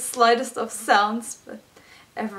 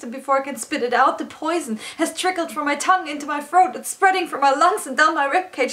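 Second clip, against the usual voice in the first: higher, louder, urgent poetic outpouring; anguished climax; 5 semitones higher, 5 dB louder than usual. Acted. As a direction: let the distress spill out, high and insistent.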